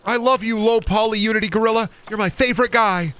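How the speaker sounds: high doting coo; gushing high voice. Acted. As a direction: coo at it like a pet. Gushing to gorilla.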